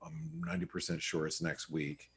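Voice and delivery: hushed, low half-whisper; muttering to self. Real, not acted. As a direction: think aloud under your breath while checking. Checking the date to himself.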